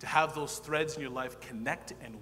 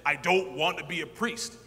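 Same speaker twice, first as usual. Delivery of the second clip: louder, half-laughing protest; mock-angry loud protest; retold protest; 4 semitones higher, 10 dB louder than usual. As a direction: argue it, laughing at yourself. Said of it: "I fought with this man".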